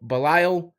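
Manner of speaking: "Belial" stretched out as be-LIE-al; wrong name. Retold or imitated. Fan imitating a commentator saying "Belial". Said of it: "incorrectly like he says it".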